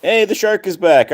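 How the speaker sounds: high, loud hey; excited greeting. Real, not acted.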